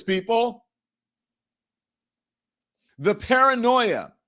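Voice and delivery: high-pitched, exasperated tirade; worked-up, indignant outburst; heated rant. Real, not acted. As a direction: rant from experience, voice pitched well up. Heated rant about paranoid boss.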